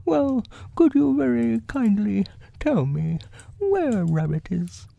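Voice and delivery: high, polite bear voice; Pooh. Acted. Bear politely asking where his friend is, high voice. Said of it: "and said".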